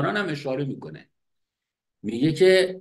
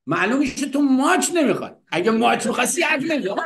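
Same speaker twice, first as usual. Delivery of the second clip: louder, higher, fast laughing scold; 9 semitones higher, 4 dB louder, faster than usual. Retold or imitated. Wife teasing Hafez about kisses, louder laughing scold. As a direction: tease him with a laughing shout.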